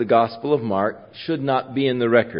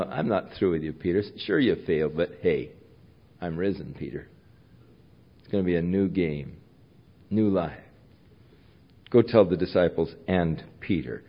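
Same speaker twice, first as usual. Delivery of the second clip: soft, lower reassuring voice; gently restore a friend; softly reassuring risen Lord; tender Jesus; 4 semitones lower, 5 dB quieter than usual. Acted. Preacher voices Jesus to Peter.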